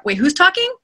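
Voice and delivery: high, puzzled double-take; mock confusion. Narrator catching herself in the wrong accent, confused. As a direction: break off and query it, pitched right up. Acts out noticing wrong character voice.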